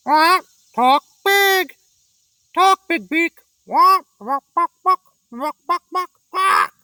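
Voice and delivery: high, loud, slow squawking parrot taunt; a parrot mocking the prince; rude parrot. A narrator's reading. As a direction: squawk insults like a rude bird. Parrot voice held.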